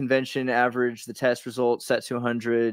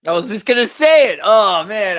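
excited interjection, much higher and louder; excited outburst; 8 semitones higher, 10 dB louder than usual. Real, not acted. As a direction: jump in loud and high, thrilled. Bursts in on co-host's example.

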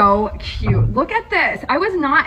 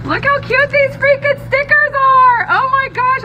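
high, loud gushing; excited squeal; 4 dB louder than usual. Real, not acted. Showing Dingbat's stickers to viewers, high excited squeal. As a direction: gush over them high and loud.

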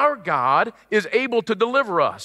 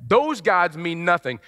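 usual speaking voice

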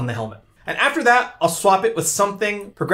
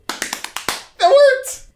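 high thrilled squeal; excited squeal. Real, not acted. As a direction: squeal with delight.

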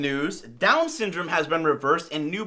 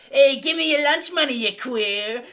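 higher, louder tough bully voice; Boston bully; 6 semitones higher, 5 dB louder than usual. Retold or imitated. Schoolyard bully demanding lunch money, higher, louder tough bully voice. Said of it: "They're all like".

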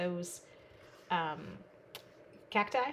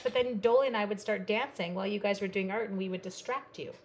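ordinary talk

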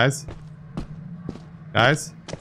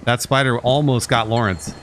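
ordinary talk, nothing marked

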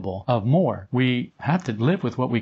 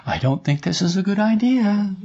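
high, small voice of conscience; quiet inner voice; 7 semitones higher than usual. Retold or imitated. Inner part warning the self, small high voice. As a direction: object timidly in a raised little voice. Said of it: "it's a very quiet part of us".

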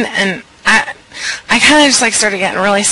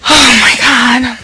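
slow alarm; 3 semitones higher, 6 dB louder, slower than usual. Acted. Hearing a car.

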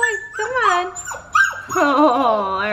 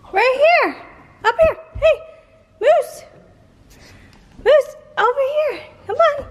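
very high, bright pet-calling voice; high coaxing calls to a puppy; 13 semitones higher, 3 dB louder than usual. Real, not acted. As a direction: sing out to lure the dog over. Calling the dog from afar.

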